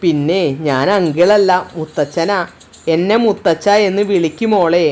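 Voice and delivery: a grandfather wiping tears, gently; emotional older man. Acted. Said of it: "കണ്ണുകൾ അമർത്തി തുടച്ചുകൊണ്ട് അയാൾ പറഞ്ഞു".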